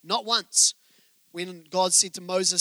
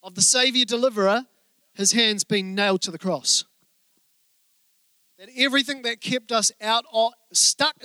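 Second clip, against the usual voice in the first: preaching; 4 dB louder than usual. Acted. Preaching climax.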